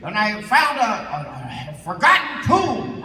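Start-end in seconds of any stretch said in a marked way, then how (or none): 1.7-3.0 higher, louder grand announcement; mock explorer. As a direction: boast like a pompous adventurer. Mock explorer bit, grand telling.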